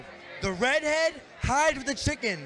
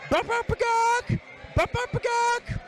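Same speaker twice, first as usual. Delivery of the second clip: high bup bup ba gawk clucks, much higher than her voice; mock chicken clucking; 11 semitones higher than usual. Brunette hiding from the farmer, high mock chicken clucks. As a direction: cluck like a hen to fool the farmer. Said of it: "And the brunette goes".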